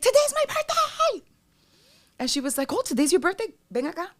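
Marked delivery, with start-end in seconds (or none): 0.0-1.3 very high, loud yell; calling out. Acted. Yelling out in quiet theater. 2.7-4.1 high, loud, delighted star; singer on stage. Acted. Singer answers her from stage.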